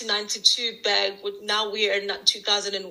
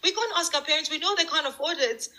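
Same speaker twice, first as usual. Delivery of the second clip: high-pitched re-enacted worrying; acted stressed; 7 semitones higher than usual. Sisters awake at night over trip money, acted stressed. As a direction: fret in a higher voice, mildly dramatised. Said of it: "I'm also stressed".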